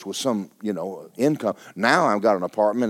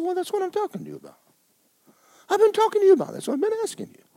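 very high, fast put-on voice; aggravated protest; much faster than usual. Arguing back at the Lord, aggravated. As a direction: imitate an exasperated high-pitched complaint. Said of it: "And he aggravated me".

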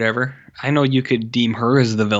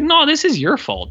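mock blame; 4 dB louder than usual. Husband blaming her, mocked.

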